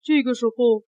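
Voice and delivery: ordinary delivery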